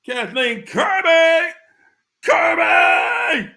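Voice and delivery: high, loud, stretched-out name call; playful holler. Real, not acted. Host greeting a viewer on livestream, exuberant shout. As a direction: holler the surname like a hype man.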